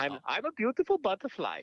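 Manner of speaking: put-on high German-accented caterpillar line. Quoting Heimlich's line in voice.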